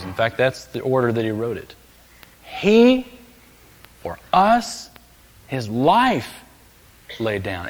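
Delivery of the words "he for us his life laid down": each phrase set apart by long pauses; emphatic phrasing. Shown as a demonstration. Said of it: "That was his emphasis".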